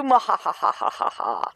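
slow evil cackle; hammed-up villain laugh; mad scientist laugh. After tricking the game.

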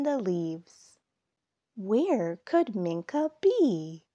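lively storytime read-aloud; reading to children. A narrator's reading. Narrator reading a fall story to young listeners, storytime voice. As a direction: tell it like a picture book for little kids. Reads picture book aloud.

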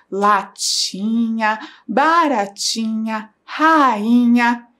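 Latinha and baratinha each drawn out slowly; slow syllable reading. Shown as a demonstration. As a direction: model the words slowly for young readers. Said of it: "com o som do nha".

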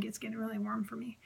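quick breathy whisper; whispered aside. Real, not acted. Medium telling viewers she feels warm, whispered. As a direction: murmur it as the feeling hits. Feeling spirit energy.

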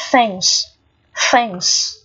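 "thanks" opened with a voiceless TH; tongue between teeth. Shown as a demonstration. Teacher demonstrates TH for learners, slowly modelled. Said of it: "Cũng là lưỡi giữa răng".

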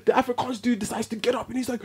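ordinary delivery

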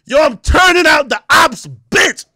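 loud, high hype yell; hype shout. Retold or imitated. Mocking a video title, in a loud hype shout. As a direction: bellow it like a drill caption. Hyped mock caption.

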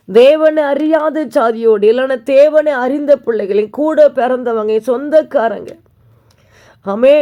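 a pastor thundering each phrase at the congregation; fiery preaching. Acted. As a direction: build the sermon to a slow, loud peak. Preaching climax.

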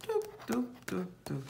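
soft, whispered step noises; imitated footsteps. A narrator's reading. Boucle d'Or climbs to the bedroom, soft footstep sounds. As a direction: tiptoe sounds, fading to a whisper.

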